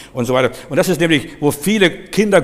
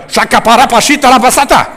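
high, shouted burst of tongues; commanding tongues; 10 semitones higher, 10 dB louder than usual. Shown as a demonstration. Preacher demonstrating tongues against spirits, fierce high shout. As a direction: shout the syllables like an attack. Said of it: "da darfst du nicht zimperlich sein".

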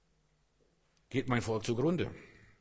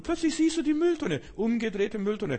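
much higher, louder burst of wonder; sudden revelation; 11 semitones higher, 5 dB louder than usual. Acted. Preacher relives his vision of the upturned bin, excited. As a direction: exclaim it as if the vision just appeared.

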